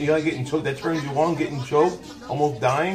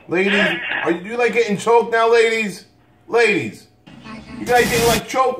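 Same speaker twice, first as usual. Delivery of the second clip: incredulous appeal; 7 semitones higher, 3 dB louder than usual. Real, not acted. Incredulously calling out to the ladies.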